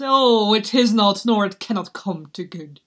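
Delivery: loud cry, fading towards the end; anguished foreboding. Acted. Hamlet dreading what the marriage brings, loud anguish subsiding. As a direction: wail the first words, then subside.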